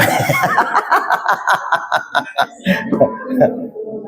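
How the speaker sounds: long, breathy run of chuckles; amused laugh. Real, not acted. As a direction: laugh softly at your own joke. Jokes that men just listen.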